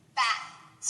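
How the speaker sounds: Bat ending in air only; voiceless t. Shown as a demonstration. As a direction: Bat with the throat still on the t. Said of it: "no vibration at the end".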